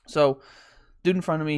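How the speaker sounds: ordinary talk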